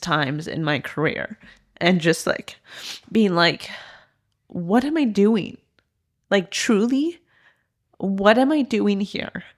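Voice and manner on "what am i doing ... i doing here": despairing question. Retold or imitated. Despairing inner question retold.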